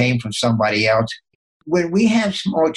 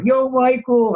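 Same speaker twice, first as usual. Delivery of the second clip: calling out; 10 semitones higher, slower than usual. Retold or imitated. Crowd calling out his name.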